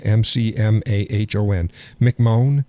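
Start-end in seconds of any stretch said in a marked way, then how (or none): 2.0-2.6 "McMahon" said as mick-MOAN; alternative pronunciation. Shown as a demonstration. Host testing how to say the nominee's surname, mick-MOAN pronunciation. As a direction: end "McMahon" in a long O, questioning. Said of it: "How do you pronounce that?".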